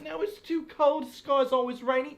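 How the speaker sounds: mock British complaining. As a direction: moan theatrically about the weather. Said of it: "complaining about things".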